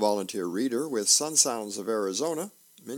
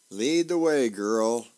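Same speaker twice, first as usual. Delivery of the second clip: loud, raised command to a dog; calling to a dog; 8 dB louder than usual. Acted. Wife sends her dog searching for husband, calling out. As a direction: send the dog off with a loud call.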